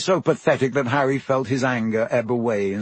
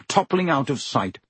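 ordinary delivery

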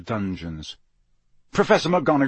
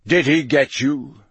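louder growled question; low gravelly growl; 5 dB louder than usual. A narrator's reading. Moody checking on Harry after the attack, gravelly growl. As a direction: growl it out roughly and firmly. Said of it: "Moody growled. His voice was low and gravelly".

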